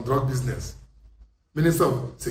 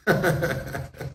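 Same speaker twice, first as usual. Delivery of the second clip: scornful laugh; 5 dB quieter than usual. Real, not acted. Laughs after the taunt.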